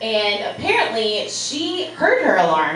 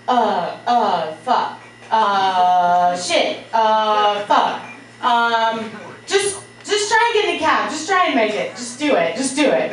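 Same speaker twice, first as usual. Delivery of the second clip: flustered panic; 3 semitones higher than usual. Retold or imitated. Olivia flustered, just woken.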